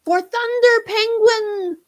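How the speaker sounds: high, loud, slow war cry; battle scream. Acted. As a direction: scream it as you charge.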